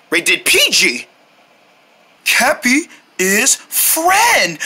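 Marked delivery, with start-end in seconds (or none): 2.2-4.6 very slow creature speech. Pretend. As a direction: say it like a simple creature. Cappy character talks.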